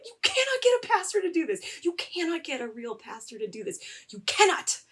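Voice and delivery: high-pitched, emphatic disbelief; retold outraged objection; indignant protest. Retold indignant outburst at the pastor.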